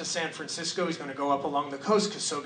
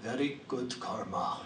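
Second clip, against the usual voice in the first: soft slow smiling monk; 5 semitones lower, 8 dB quieter, slower than usual. Retold or imitated. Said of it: "and he goes".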